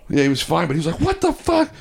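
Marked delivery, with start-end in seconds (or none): 1.0-1.7 much higher, stunned exclamation; jolted by the blast in his face; startled outburst. Retold or imitated. Power guy after the breaker box explodes, high startled outburst.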